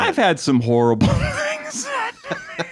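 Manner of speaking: laughing shout. Real, not acted. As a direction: blurt it out laughing. Outraged comic complaining, laughing.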